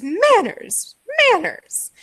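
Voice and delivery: scolding showman. Acted. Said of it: "he goes".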